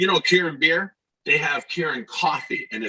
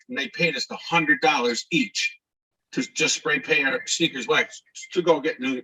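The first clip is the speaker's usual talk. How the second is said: ordinary delivery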